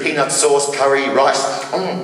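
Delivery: usual speaking voice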